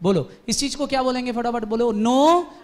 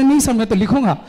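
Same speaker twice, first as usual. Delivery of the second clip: stubborn loud insistence; 7 dB louder than usual. Acted. Deepak insists in the quarrel.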